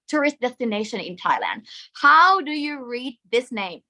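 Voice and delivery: ordinary talk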